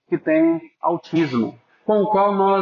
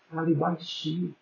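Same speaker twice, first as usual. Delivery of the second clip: hushed; 10 dB quieter than usual. Shown as a demonstration. Preacher showing adults how to speak to children, hushed. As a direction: say it softly, as if beside a child. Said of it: "Você falando baixinho".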